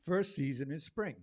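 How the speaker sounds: ordinary delivery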